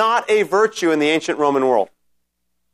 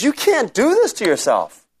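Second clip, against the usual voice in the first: a lightly voiced, higher Peter pleading; a hint of an upset disciple; Peter's protest; 6 semitones higher than usual. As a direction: Peter objecting to his master. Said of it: "Peter is standing up and defending".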